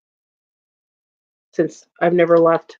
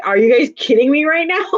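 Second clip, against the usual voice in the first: higher, incredulous retold question; mock disbelief; 6 semitones higher than usual. Retelling her shock at the beach gator, mock disbelief. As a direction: act out your shock at the gator. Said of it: "I was like".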